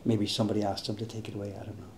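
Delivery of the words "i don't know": usual speaking voice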